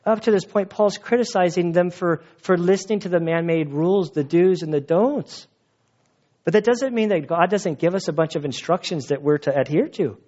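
usual speaking voice

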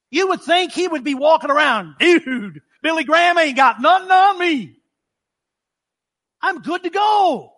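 puffed-up self-congratulation, high pitched; cocky boast. Acted. Prophet imagined strutting after the revival, cocky.

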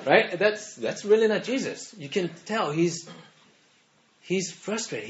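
ordinary talk, nothing marked

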